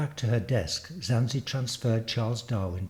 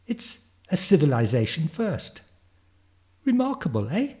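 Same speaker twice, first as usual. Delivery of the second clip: put-on higher-pitched, eager child prodigy; eager girl of the future; 6 semitones higher than usual. Future girl introducing herself to Darwin, eager child voice. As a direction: play a keen young girl, pitched up.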